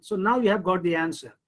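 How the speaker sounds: usual speaking voice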